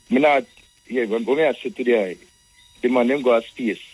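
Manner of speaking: ordinary talk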